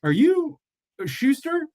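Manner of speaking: high startled question, retold; surprised question. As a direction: ask it wide-eyed and high. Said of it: "and he's like".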